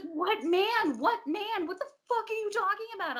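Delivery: loud, high-pitched bewildered demand; imitated exasperated shout. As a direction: act out an alarmed boyfriend shouting back. Boyfriend's bewildered shouting, acted out.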